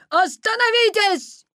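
high, loud yell after running; urgent high-pitched cry; breathless shout. A narrator's reading. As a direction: shout for him to stop. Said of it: "закричал Петя, задыхаясь от быстрого бега".

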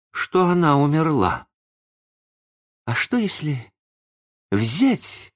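high, halting musing; bewildered. A narrator's reading. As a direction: think aloud, at a loss what to do. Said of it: "сказал он в замешательстве".

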